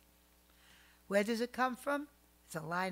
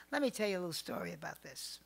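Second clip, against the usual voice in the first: ordinary talk, nothing marked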